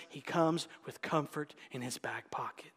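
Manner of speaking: playful confiding whisper. Acted. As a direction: let them in on a secret. Tender aside.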